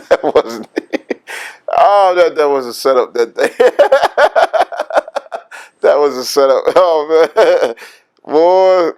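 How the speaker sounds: helpless real laughter breaking into the words; laughing. Real, not acted. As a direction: crack up while getting the words out. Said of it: "excuse me for laughing".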